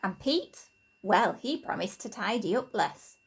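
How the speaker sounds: ordinary delivery, nothing marked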